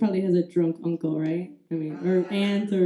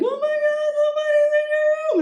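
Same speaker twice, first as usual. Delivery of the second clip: very high, squeaky panicked wail; hammed-up sobbing squeal; mock crying. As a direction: imitate a hysterical girlfriend in tears.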